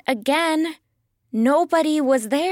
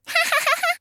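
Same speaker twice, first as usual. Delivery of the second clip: high, quick giggle; a rapid high titter. Acted. Narrator laughs at silly tricks.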